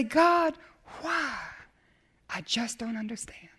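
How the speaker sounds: fading to a whispered I just don't understand; crying out. Retold or imitated. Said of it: "you can cry and say".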